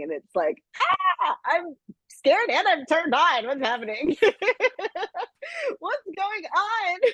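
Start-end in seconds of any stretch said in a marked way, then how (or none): 1.2-7.0 high, slow, giggling mock panic; mock scared thrill. Audience member flustered by the growling Duke, high giggling mock fright. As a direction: squeal in flustered half-fear through laughter.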